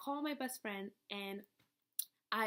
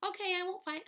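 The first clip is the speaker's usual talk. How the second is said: mock innocent friend; 10 semitones higher, 3 dB louder, faster than usual. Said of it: "And she's like".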